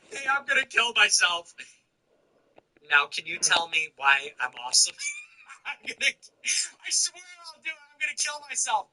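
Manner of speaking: high, hammed-up needy voice; a mocking voice of someone begging for validation; mock whiny attention seeker. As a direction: overplay a needy person demanding praise.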